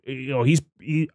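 usual speaking voice

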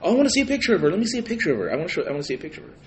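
high, eager mock friends. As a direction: beg to see it excitedly. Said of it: "And they're like".

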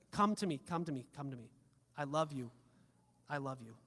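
soft, low, gentle plea; tender invitation. Acted. God tenderly calling people to him.

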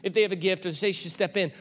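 high, eager coaxing questions; urging her over to speak; bright eager coaxing; excited asking. Retold or imitated. Pastor voices eager question to Cammie.

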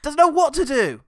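high, loud mock jeering; hammed-up excited yell; mock triumphant. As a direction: shout it like a gloating ringside commentator. Mock commentator gloating over the blow.

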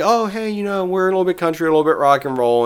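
excited. Retold or imitated. Townsfolk voiced in retelling.

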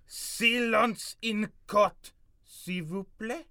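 slow, high, stern French command; French judge accent. Acted. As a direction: call the court to order.

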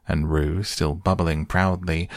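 plain reading voice

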